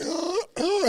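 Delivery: high, playful "uh, uh" hum; spirit's teasing hum. Retold or imitated. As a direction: tease like a spirit nudging you. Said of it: "she'll come in like".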